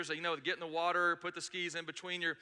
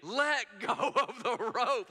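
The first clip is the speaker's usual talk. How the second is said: high, loud shouted warning with a laugh; laughing shout from the boat; people yelling, laughing; 5 semitones higher, 4 dB louder than usual. Retold or imitated. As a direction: yell from the boat to let go. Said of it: "literally yelling at me".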